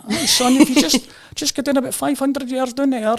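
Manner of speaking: put-on loud, high old local man's Shooter accent. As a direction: play the old boy with a thick Shooter accent, pitched well up. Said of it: "You know, that Shooter accent".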